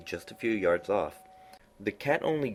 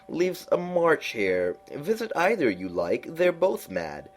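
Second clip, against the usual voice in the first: high, slow, drawn-out cat voice; lofty knowing cat, high and slow; Cheshire Cat voice; 10 semitones higher, 3 dB louder, slower than usual. A narrator's reading. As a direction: purr it out like a sly grinning cat.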